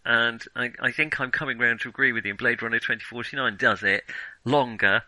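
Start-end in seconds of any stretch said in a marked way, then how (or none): none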